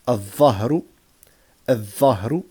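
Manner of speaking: loud "al-dhahru", L silent, held double dh; doubled first letter. Shown as a demonstration. Teacher drilling sun-letter words, doubled dh. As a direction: say "al-dhahru" as adh-dhahru. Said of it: "on va doubler".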